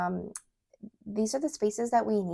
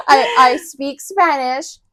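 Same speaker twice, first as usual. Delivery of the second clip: laughing; 8 semitones higher, 14 dB louder, slower than usual. Real, not acted. Laughing after Chilean remark.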